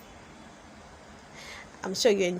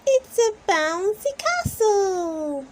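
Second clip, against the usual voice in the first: put-on high, loud little-girl cry; thrilled girlish shout; excited cry; 11 semitones higher, 5 dB louder than usual. A narrator's reading. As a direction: burst out with joy at the castle. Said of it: "Peppa cries".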